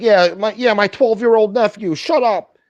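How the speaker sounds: high-pitched, loud mock of his excuse; mocking imitation. Streamer mocking a man's nephew excuse, high and loud. Mocking retold quote of the man.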